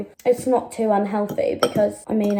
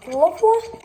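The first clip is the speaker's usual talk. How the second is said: pleased; 10 semitones higher than usual. Real, not acted. Pleased with coffee mix.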